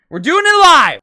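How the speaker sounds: high, loud declaration; defiant shout. Real, not acted. Vlogger giving up on music, shouting defiantly. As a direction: shout it like a battle cry.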